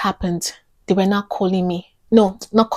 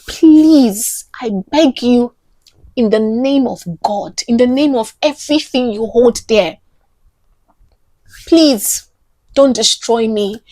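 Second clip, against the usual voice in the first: urgent, pained retold plea; begging; 3 semitones higher, 4 dB louder than usual. Actor begging the scammer not to destroy her, desperate. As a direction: plead hard, pressing on each phrase. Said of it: "I was begging".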